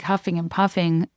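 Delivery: usual speaking voice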